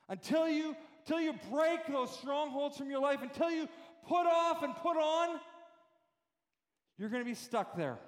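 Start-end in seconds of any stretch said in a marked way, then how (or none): 0.1-5.4 rising preacher's shout, higher and louder; preaching at full force. Acted. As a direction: build the repeated until into a shout.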